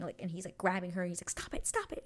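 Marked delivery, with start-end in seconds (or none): 1.3-2.0 very high, frantic pleading without shouting; mock freak-out. Acting out him grabbing her over a bee, high mock panic. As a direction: imitate his panic in a squeaky rush. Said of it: "he is starting to freak out".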